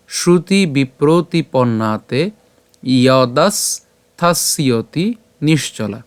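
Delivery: slow shloka recitation with a break after each word group; pure Sanskrit pronunciation. Shown as a demonstration. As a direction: recite slowly, stretching the long vowels. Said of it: "আবার প্রথম থেকে বলি". (ধীরে, ভেঙে ভেঙে শ্লোক পাঠ)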